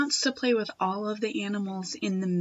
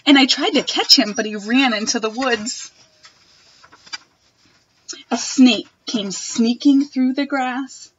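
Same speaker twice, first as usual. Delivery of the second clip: put-on expressive read-aloud for children; reading a picture book to a class; storybook reading; 5 dB louder than usual. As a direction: read it like story time for little kids.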